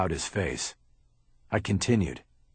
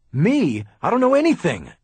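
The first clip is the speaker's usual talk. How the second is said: loud, much higher protest; indignant denial; 10 semitones higher, 7 dB louder than usual. Acted. Haller accused by Bosch, loud indignant yell. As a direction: fling the denial back at him. Haller denies knowing anything.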